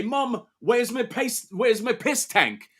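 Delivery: lightly hammed British accent, slow; a kid whining for his toy in a mock British accent. Son asking his mum for the toy, mock British accent. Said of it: "uh, British all of a sudden".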